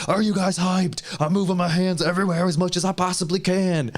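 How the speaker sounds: high pumped-up voice mocking a gamer; hammed-up hype imitation; mock hyped streamer. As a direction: overact a streamer flailing his arms. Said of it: "But he's like".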